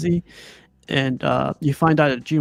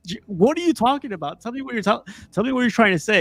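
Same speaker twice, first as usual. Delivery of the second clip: higher, puzzled mock yell; retelling a confused Gene Wilder, mock bewildered; 8 semitones higher, faster than usual. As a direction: act baffled and insistent.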